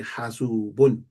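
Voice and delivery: slow, careful "hasubun" with full "-bun"; "hasubun" slowly, syllable by syllable to the final n; every sound pronounced. Shown as a demonstration. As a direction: draw out "hasubun", sounding the ending fully. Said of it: "We wanna pronounce every single thing".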